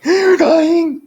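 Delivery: gasped line. Shown as a demonstration. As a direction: gasp while blurting the words out high. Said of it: "He's not actually doing that while he's saying it".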